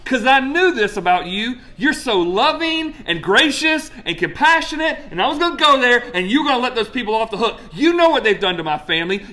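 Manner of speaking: high, pleading gripe at God; whiny complaining Jonah. Retold or imitated. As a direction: whine it like a wronged child.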